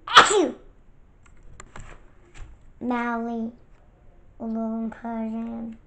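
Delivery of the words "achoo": big loud sneeze; a loud real sneeze. Real, not acted.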